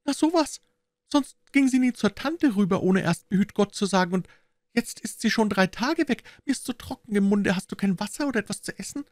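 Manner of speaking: put-on high-pitched child prattling on; very high five-year-old's voice; chattering little girl. A narrator's reading. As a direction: play a small girl puzzled and complaining. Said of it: "ein etwa fünfjähriges Mädchen".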